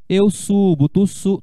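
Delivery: ordinary delivery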